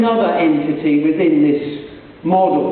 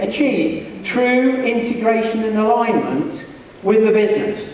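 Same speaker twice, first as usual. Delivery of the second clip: usual speaking voice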